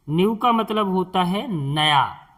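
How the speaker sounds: ordinary talk, nothing marked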